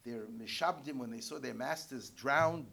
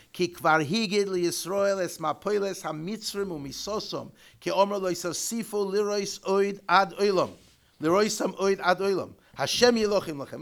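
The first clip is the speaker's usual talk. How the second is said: louder, chanted reading of the Hebrew source; loud text reading; 4 semitones higher, 11 dB louder than usual. Acted. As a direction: declaim the commentary as if reading it to the class. Reads Hebrew commentary text aloud.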